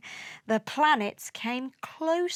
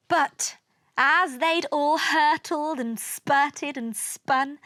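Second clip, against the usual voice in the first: ordinary delivery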